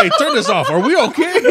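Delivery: high, loud laughing worry. Retold or imitated. Asking his friend if they are okay, laughing. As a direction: ask it laughing, mock concerned. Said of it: "I'm like".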